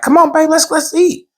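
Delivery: high-pitched, eager invitation; retold excited urging; eager coaxing. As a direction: coax your wife to the food in a high voice. Retold coaxing wife to eat.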